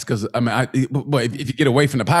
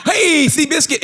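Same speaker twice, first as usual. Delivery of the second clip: mock calling out; 10 semitones higher than usual. Cowboy calling to rearing horse.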